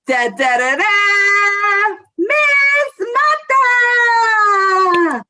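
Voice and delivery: rising, drawn-out big introduction; game show announcer. Acted. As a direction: build it up like a game show host.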